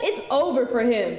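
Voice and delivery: normal talking voice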